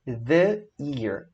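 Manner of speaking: "the ear" said thee year; "ear" with a Y onset, thee; thee before year. Shown as a demonstration. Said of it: "no exemplo".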